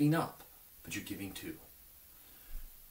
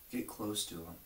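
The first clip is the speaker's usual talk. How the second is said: ordinary talk, nothing marked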